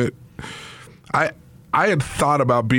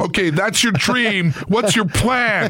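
higher, slow, lightly voiced skeptical dad; a slow, raised, half-joking fatherly question; mock dad quizzing his son's dream, slow and higher; imitating Steve Young's dad; 7 semitones higher, slower than usual. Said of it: "He pulled Steve Young's dad".

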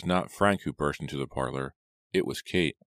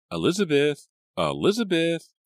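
worried call; 12 semitones higher, 5 dB louder than usual. A narrator's reading. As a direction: call after a runaway sister, anxious. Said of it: "Kate's worried call".

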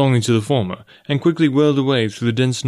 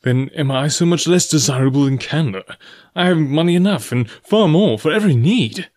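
raised, louder bitter outburst; 5 semitones higher, 4 dB louder than usual. A narrator's reading. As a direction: demand it bitterly, pitch swinging high on the stressed words. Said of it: "he said bitterly".

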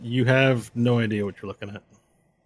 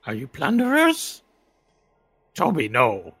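high creepy voice talking to itself; paranoid, questioning dark creature; eerie creature voice; 11 semitones higher, 5 dB louder than usual. Acted. Creature talks to Toby and party.

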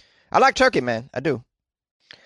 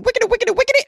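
very high squeaky sing-song chant; high cartoonish chant of the show's catchphrase; 3 dB louder, much faster than usual. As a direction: chirp the jingle way up high. Show jingle catchphrase.

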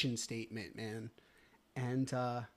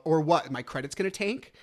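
loud, raised-pitch challenge, retold; retold defiant retort; 3 semitones higher, 8 dB louder than usual. Man retells challenging his lawyer about credit, defiant. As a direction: throw the question back loudly, as if arguing.

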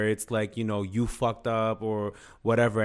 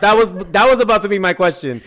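much higher and louder; excited outburst; 8 semitones higher, 11 dB louder than usual. Real, not acted. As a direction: blurt it out over her. Excited interruption, same thought.

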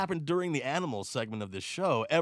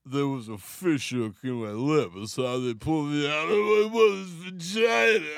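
slack-mouthed slur, as if lip-hooked; Stallone; 6 dB louder than usual. Acted. Boxer-actor explaining his own birth, slurred and building. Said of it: "Stallone being born".

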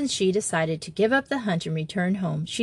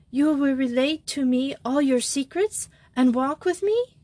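lightly voiced eager girl; 6 semitones higher than usual. A narrator's reading. Simone pressing Jo.

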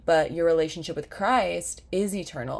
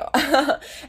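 embarrassed laugh; 9 semitones higher, 6 dB louder than usual. Real, not acted. Laughs at herself.

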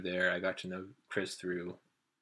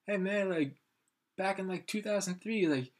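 high, raised imitation of a stranger; retold excited revelation; 9 semitones higher, 5 dB louder than usual. Stranger tells him of brother's band, high excited retelling. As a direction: play the stranger piecing it together, pitched up.

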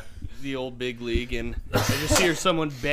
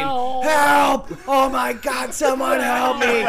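high loud mock cries for help; retold screaming; 11 semitones higher, 9 dB louder, much slower than usual. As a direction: yell it like a drunk stuck in the basement. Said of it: "I hear him screaming".

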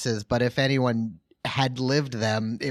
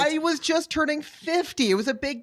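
much higher, bashful protest; high defensive whine; 13 semitones higher than usual. Acted. Gene character defending his fiftieth party, high bashful whine.

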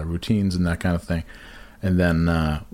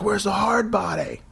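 high-pitched holler across a room; re-enacted catcall in a raised, high voice; calling out; 11 semitones higher than usual. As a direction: call it out, pitched way up. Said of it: "so I called out".

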